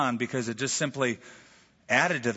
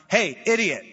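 louder, higher rude call-out; hammed-up scornful hail; mock insult; 6 semitones higher, 5 dB louder than usual. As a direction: blurt it like a playful heckle.

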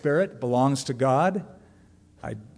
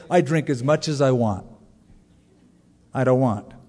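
dry answer, the last line louder; playful punchline; 5 dB louder than usual. Retold or imitated. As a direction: deliver the joke answer with a punch at the end. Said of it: "I say".

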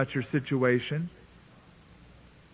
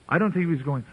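ordinary talk, nothing marked

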